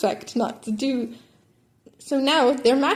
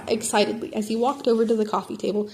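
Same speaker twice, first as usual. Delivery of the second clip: ordinary delivery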